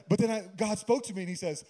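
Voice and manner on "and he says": ordinary talk, nothing marked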